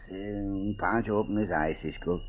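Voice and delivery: sleepy mumble. Acted. Just woken from sleep.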